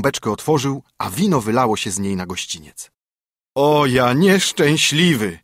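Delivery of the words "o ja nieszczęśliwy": slow, drawn-out wail of woe; groaning lament. A narrator's reading. Carter groans as his second wine barrel spills out, slow and woeful. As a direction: moan it as if all is lost. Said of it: "jęknął znowu woźnica".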